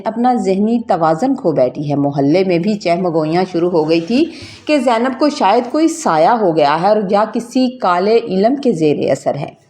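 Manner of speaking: plain reading voice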